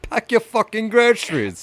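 high-pitched British parody; exaggerated British mimicry; high mock British. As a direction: squeal the catchphrase in a British accent.

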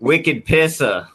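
drawn-out Boston "wicked pissa"; Boston accent on request, slow. Shown as a demonstration. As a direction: say "wicked pissa" slowly, Boston style with no final R. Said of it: "Say it".

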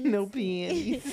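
high slow teasing echo; laughing high echo. Real, not acted. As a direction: drawl it back, laughing. Repeating higher, slow, laughing.

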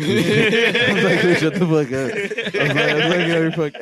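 high, loud and slowed by laughter; real cracking up through the words; laughing. Real, not acted. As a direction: barely get the quote out for laughing. Cracking up at own retold quotes.

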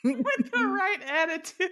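high, far-off pep-talk voice; mock motivational coach. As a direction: parrot it like a cheesy life coach. Echoes friend in mocking pep voice.

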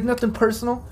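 high-pitched, hurried captive's plea; pleading prisoner. Acted. Game master as wounded prisoner asking for release, pleading. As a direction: beg to be let go, lightly acted. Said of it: "He coughs up some more blood".

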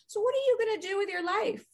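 lightly imitating Dad's proper English accent. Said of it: "in his English proper accent".

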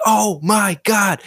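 high, drawn-out cry of disbelief; freaking out. Retold or imitated. Pilot retelling a near collision he watched, freaking out. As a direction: stretch it out high, reliving the panic. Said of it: "I'm freaking out".

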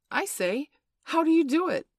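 put-on high, loud boyish asking; eager question. A narrator's reading. John asks Peter how he flies, eagerly.

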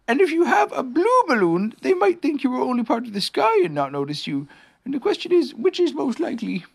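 high-pitched bear laying out a plan; Pooh bear, explaining earnestly. Acted.

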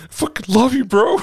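high, squeaky weeping through laughter; fake-sob it while laughing; hammed-up drunken blubbering; mock crying. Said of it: "and crying".

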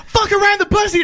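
high, far-off yelled prank line; prankster shout. Retold or imitated. Retelling the guy who runs up to the reporter, high yell. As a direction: bark it at the reporter and run.

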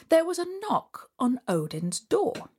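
ordinary delivery, nothing marked

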